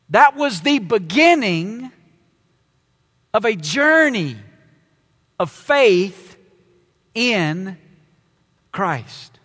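beginning set apart, then slow, spaced phrase; emphasis on beginning. Shown as a demonstration. Preacher to congregation, stressing beginning with spaced pauses. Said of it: "I want to put the emphasis on the word began".

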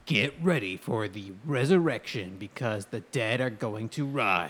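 slow trailer narrator voice; movie trailer voice. Acted. Reading movie description dramatically.

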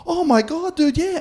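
very high eager dude voice; high-pitched mock naive teen; gullible teenage self. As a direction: sound like a clueless kid eager to help.

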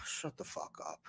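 hushed, breathy whisper. Retold or imitated. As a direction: whisper it under your breath. Dismissing annoying internet commenters.

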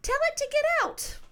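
high-pitched imitation of mom; mock high mom voice; mom's advice. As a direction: pitch it way up as mom giving an order. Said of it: "my mom's like".

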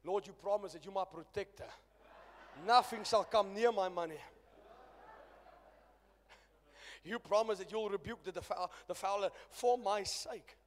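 calling out to the Lord, pleading loudly; a loud, half-playful plea for his money; loud mock prayer. Preacher retelling his loud prayer to God over money.

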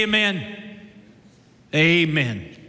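put-on old timers' amen. Old timers answering the preacher.

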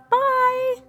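high sing-song goodbye; cheery bye. Real, not acted. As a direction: toss off a bright high bye. Sign-off goodbye.

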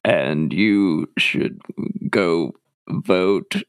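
swallowed throaty voice imitating cohost. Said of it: "How do you sound so much like me?".

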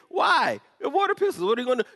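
high, loud mocking disbelief; shrill-free high scoffing doubter; incredulous skeptic. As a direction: squawk it like a doubter who thinks you're crazy. Skeptic scoffs at water pistol plan.

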